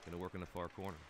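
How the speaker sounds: brisk running hockey commentary; live play-by-play. Acted. Commentator calling the game for viewers, brisk play-by-play. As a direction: call the action as it happens.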